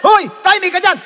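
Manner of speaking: high, loud rebuke; shouted hushing. Acted. Someone in the crowd hushes Bartimeus, yelling. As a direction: yell to shut him up. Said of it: "nung pinigilan siya".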